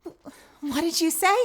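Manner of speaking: put-on high line that cracks like a teenage boy; a botched high take with a crack in it; a girl's voice that breaks by mistake. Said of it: "Hello, teenage voice. Fucking voice crack".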